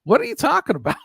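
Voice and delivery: high-pitched incredulous retort; imitating puzzled protest; retold bafflement. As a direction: act out being baffled, pitch jumping up. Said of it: "I'm just like".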